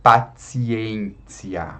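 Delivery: "Paciência" very slowly, "pa-tsi-en-tsi-a"; softened T. Shown as a demonstration. Teacher showing the other ti, "Paciência" with TS. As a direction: make both T sounds TS in "Paciência". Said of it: "aqui tem outro ti também".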